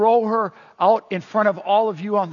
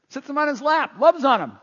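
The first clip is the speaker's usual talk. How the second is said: normal talking voice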